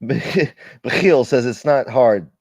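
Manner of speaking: ordinary delivery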